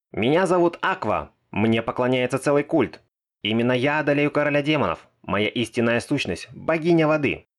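loud, high proud goddess boast; shouting proudly. Acted. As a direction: boast at the top of your voice. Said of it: "с кричащей Аквы". (громкое хвастовство богини)

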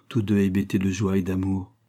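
usual speaking voice

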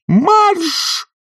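very high drawn cry; piercing command; shout. A narrator's reading. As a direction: yell the order to scare her off. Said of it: "закричал он".

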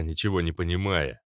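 plain reading voice

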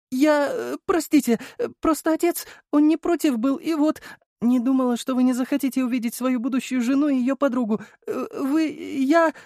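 высокий сбивчивый голос, почти плач; растерянно запинается на каждом слове; запинаясь. Acted. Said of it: "запиналась девушка". (сбивчиво, растерянно, запинаясь)

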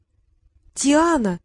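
a mildly voiced little boy calling out; boy's cry. A narrator's reading. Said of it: "закричал худенький маленький мальчик".

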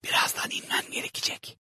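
soft unvoiced whisper. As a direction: whisper it close to her ear. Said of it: "diye fısıldadı Rachel".

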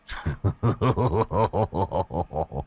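soft menacing laugh; a cruel quiet cackle; creepy breathy chuckle; sinister laugh. Acted. Killer laughs at terrified wife.

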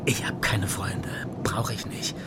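low hushed whisper, slow; whispered confession. Acted. Viehweg confides in Doberschütz in the car, whispering. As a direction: let it slip out under the breath.

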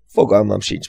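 ordinary delivery

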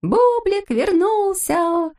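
high, drawn-out happy cry; joyful shout. A narrator's reading. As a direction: call out the glad news, long and high. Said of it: "закричали все радостно".

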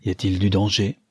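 ordinary delivery, nothing marked

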